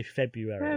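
February drawn out as "Feb-you-airy"; February softly, in four full syllables; properly but wrongly. Shown as a demonstration. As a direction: say February carefully with a "you" in the middle. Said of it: "say it properly but wrongly".

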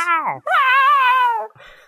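loud, high burst of laughter. Real, not acted. Big laugh.

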